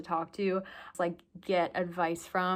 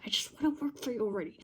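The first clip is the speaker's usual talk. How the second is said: mock impatient plea; 5 semitones higher, much faster than usual. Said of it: "and I'm just like".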